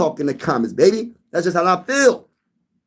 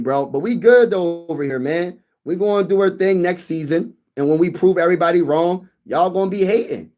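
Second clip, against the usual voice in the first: ordinary talk, nothing marked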